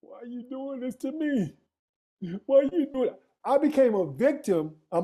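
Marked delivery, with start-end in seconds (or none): 0.0-3.2 begging his own mind to stop; own pleading voice. Retold or imitated. Man on a silent retreat pleads with his inner voice, pained and rising. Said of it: "in my own voice".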